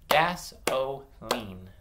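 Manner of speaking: "Gasoline" broken into separate beats; "Gasoline" slowly segmented for blending; slow syllables. Shown as a demonstration. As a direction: clap out "Gasoline" slowly, pausing between syllables. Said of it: "I'm gonna say them slow and clap".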